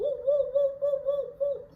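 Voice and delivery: high, loud police siren wail; siren imitation.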